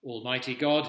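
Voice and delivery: normal talking voice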